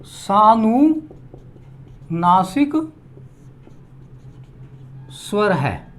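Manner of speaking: सानुनासिक drawn out syllable by syllable; teacher dictating the term; slow dictation. Shown as a demonstration. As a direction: dictate सानुनासिक slowly for note-taking. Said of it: "जैसे उदाहरण की दृष्टि से".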